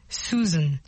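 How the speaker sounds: ordinary talk, nothing marked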